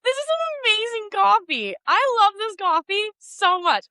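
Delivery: high, gushing delight at a great coffee; coffee lover gushing over a perfect cup. Retold or imitated. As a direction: rave about it like it made your day. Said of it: "I'm like".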